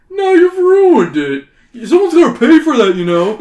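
hammed-up furious builder; angry workman. Workman scolding the superhero over the bar.

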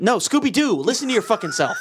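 high-pitched, incredulous comic outburst; exasperated correction. Real, not acted. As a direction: correct your friend in mock outrage. Exasperated correction of co-host's wrong guess.

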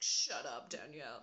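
low, lightly voiced scolding mom; mock scolding mom. Mom swearing at her daughter, mock low scold. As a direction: punch the first words, dropping low. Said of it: "my mom had just been swearing at me".

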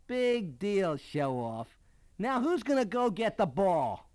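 storyteller acting a scornful kid; resentful teammate. A narrator's reading. Teammates demanding the batter fetch the ball, scornful.

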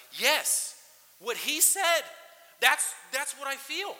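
loud, high, joyful yes; light up as if the psalm finally said it for you; eager recognition. Retold or imitated. Pastor acting a reader who finds his words in a psalm, loud excited agreement.

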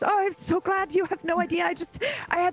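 high, tearful joyful gush. Acted. Old woman weeping over the returned friends, high. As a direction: weep with joy over them. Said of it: "I'm totally crying now".

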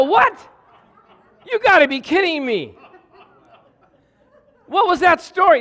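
high, loud disbelief; shocked at the man's made-up story, loud and high. Retold or imitated. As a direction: cry out in shock. Shocked discovery.

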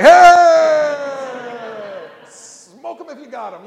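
loud, high whooping shout; crowd cheering. Retold or imitated. Diners shouting approval for free wine, whooping cheer.

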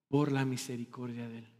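"Por la misericordia de Él" repeated softly but a little fuller. Acted. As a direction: say "Por la misericordia de Él" again, soft yet clearer. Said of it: "repeated line".

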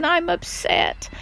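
slow, high, tearful plea, half-acted; recalling tears in the moment; retold crying. Mom retelling how she cried to the Star Keeper, mildly tearful. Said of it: "And I was crying".

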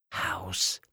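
soft secret prompt to a friend; whispered. A narrator's reading. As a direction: slip the answer over under your breath. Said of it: "flüsterte Thomas Lilli zu". (leise zugeflüstert)

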